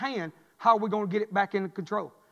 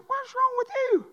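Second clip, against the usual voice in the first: concerned surprise; slower than usual. Retold or imitated. Pastor sits silent, concerned.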